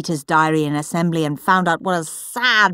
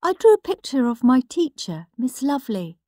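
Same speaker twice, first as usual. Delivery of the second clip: prim little brother; 6 semitones higher than usual. Acted. Peter's diary entries read out as a prim, smug little boy. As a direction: read it like a proud little swot.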